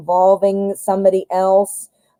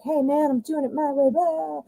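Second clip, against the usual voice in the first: defiant card woman; 7 semitones higher, 7 dB quieter than usual. Retold or imitated. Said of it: "she's like".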